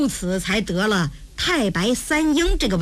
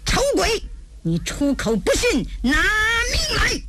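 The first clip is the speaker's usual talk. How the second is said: high, indignant battle challenge; enraged; 8 semitones higher than usual. Acted. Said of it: "气得直咬牙呀". (气得咬牙，拖长高声喝骂)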